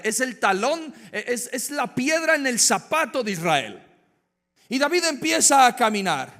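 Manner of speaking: loud, high, driving sermon voice; preaching at full force. Acted. Preacher to congregation, loud and rising. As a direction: build the story with rising fire. Preacher building the David story.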